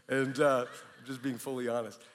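ordinary delivery

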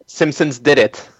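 ordinary talk

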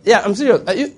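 ordinary talk, nothing marked

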